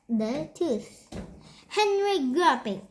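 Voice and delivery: plain reading voice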